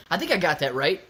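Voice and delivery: normal talking voice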